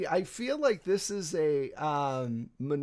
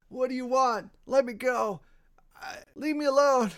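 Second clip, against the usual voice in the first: higher-pitched, strained pleas from under a captor; pinned and panicking; 8 semitones higher, 4 dB louder than usual. Acted. Pinned cultist pleading with his captor, panicked and high. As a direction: beg to be let go, struggling for air.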